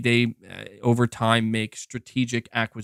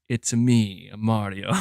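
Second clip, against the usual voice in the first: low, lightly grizzled growl; mock-gruff tough guy reveal; a hint of gritty hero; grizzled Mario; 5 semitones lower, slower than usual. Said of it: "all, like, grizzled".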